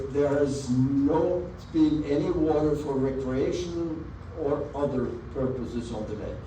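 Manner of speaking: usual speaking voice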